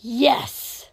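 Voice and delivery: loud, sharp insistence; forceful retort. Acted. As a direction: snap back in contradiction. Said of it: "Dobby said sharply".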